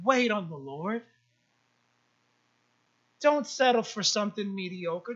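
low, soft, tender urging; God's gentle counsel. Retold or imitated. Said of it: "but He's saying".

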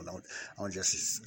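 ordinary talk, nothing marked

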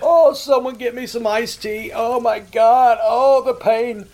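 mock the angry callers with fake suffering; sarcastic mock pain. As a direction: prank caller taunting angry callers, sarcastic hammed-up wailing. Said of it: "keep getting madder and madder".

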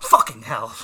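loud shocked curse; startled swear. Retold or imitated. Caught off guard by her boyfriend, a loud startled curse. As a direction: swear out loud as if you just jumped. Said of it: "I'm like".

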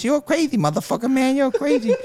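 Mike's line tossed up high and squeaky; high-voiced mimicry of a boxer's compliment; high pitched voice. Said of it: "in a high pitched voice".

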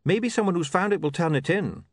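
high, quick, hopeful plea; mildly hopeful little man; unwarranted hopefulness. Acted. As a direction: suggest it eagerly in a high voice. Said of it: "with unwarranted hopefulness".

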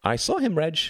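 put-on high-pitched boy piping up; boy's voice. A narrator's reading. One of the ex-Germans backs Tom, high boyish voice.

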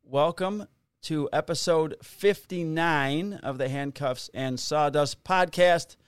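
podcast intro. Acted. Opens the podcast episode.

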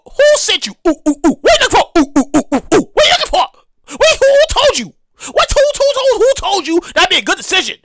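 high, hammed-up suspicious grilling; mock interrogation. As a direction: fire off questions with high whoops between.

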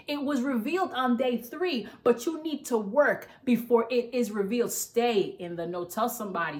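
ordinary talk

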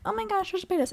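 high-pitched mock gasp of a fan; mock gushing. Mimicking obsessed people online, mock gushing. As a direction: gush like an overexcited follower.

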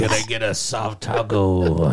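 low, dragged-out singer impression. Friend ordering at Del Taco, still in the slow singer voice. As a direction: stretch the food order out in a low voice. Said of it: "still in the voice".

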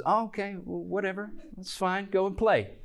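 high, offhand motherly brush-off; mock indulgent mom, pitched well up; dismissive mom. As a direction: humor the kids and send them away. Mother brushing off her children.

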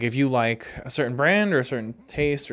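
ordinary talk, nothing marked

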